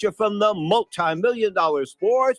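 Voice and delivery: booming high ring announcer call; lucha libre announcer. Acted. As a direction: announce it like a wrestling ring emcee. Boxing show host opening announcement.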